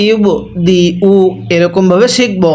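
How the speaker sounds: normal talking voice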